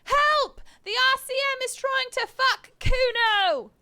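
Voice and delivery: put-on high, loud kid yell; yelling at the top of his lungs. Kuno yelling for help, high full yell. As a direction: belt it out for the whole street. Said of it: "yells from the top of his lungs".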